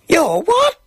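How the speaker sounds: high-pitched startled question; crying out. A narrator's reading. Boy to the voice in his gob, crying out. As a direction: cry it out high in disbelief. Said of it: "shook my head and cried out".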